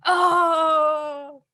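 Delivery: jubilant, sustained cheer of victory; championship win. Shown as a demonstration. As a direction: shout it like you just won the title. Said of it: "like you just won the championship".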